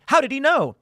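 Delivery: high, loud disbelieving question; mock crowd astonishment; amazed. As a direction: gasp it out in wonder. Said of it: "everybody was like".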